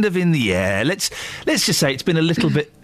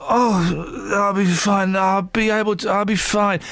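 imitating a brave sick person; 4 semitones higher, slower than usual. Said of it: "you kind of flag up you're gonna be poor".

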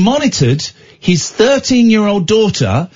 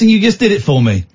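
ordinary talk

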